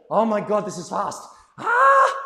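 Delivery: panicked quote building to a high shriek; mock panic. Dance teacher imitating a flustered student, mock panic. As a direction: ham up a dancer losing it at speed.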